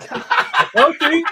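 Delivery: slow, loud I-told-you-so; gloating. Real, not acted. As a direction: drag it out smugly. Gloating after the goal.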